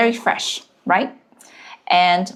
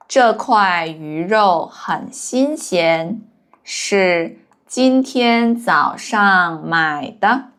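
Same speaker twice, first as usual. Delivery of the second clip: slow, careful sentence reading for learners; slow model reading; slower than usual. Shown as a demonstration. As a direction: read it out slowly, syllable by syllable. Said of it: "teacher models sentence for learners".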